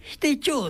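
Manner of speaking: high-pitched Nagoya してちょう; Nagoya dialect phrase. Shown as a demonstration. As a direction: lift してちょう well up in pitch. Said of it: "っていうのは".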